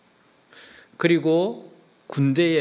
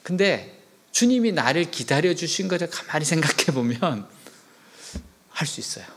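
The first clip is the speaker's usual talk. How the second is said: ordinary talk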